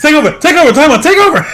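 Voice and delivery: high, loud, frantic pleading; mock panic. Fan acting out Steven begging mid-fight, mock panic.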